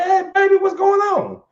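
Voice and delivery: high, sweet mother greeting her son; fond mama, voice lifted high; mother's voice. Retold or imitated. Mother voiced in a retold scene.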